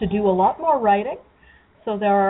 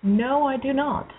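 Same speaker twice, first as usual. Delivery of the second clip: tin can sound; 4 semitones higher, slower than usual. Real, not acted. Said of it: "you sound like you're in a room that's lined with metal".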